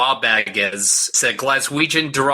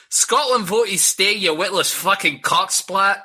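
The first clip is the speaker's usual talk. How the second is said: put-on slow Scottish accent, pitched up; 6 semitones higher, slower than usual. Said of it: "I'll do the accent".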